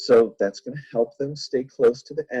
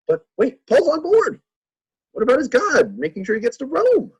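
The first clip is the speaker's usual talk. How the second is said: mock alarm; 6 dB louder than usual. Teacher raises a rhetorical challenge.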